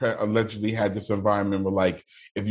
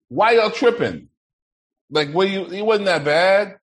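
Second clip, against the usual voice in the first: mock protest; 7 semitones higher, 4 dB louder than usual. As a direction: imitate defenders, stretching words high.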